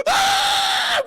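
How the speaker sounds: huge high scream of pain; agonized scream. Retold or imitated. Freeze in the transfer machine, in pain: screaming. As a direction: let out a full shriek of agony. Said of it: "and he just goes".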